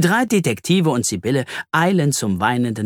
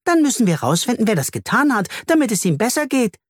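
high, eager little bee voice; keen high-voiced character; prompt bee reply; 12 semitones higher, faster than usual. A narrator's reading. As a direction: answer at once, pitched way up. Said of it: "erwidert Bertha sofort".

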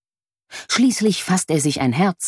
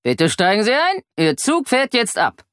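higher-pitched station announcer, lightly voiced; indistinct loudspeaker announcement; 7 semitones higher than usual. A narrator's reading. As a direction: imitate a train announcement over the speakers. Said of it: "eine undeutliche Stimme sagt".

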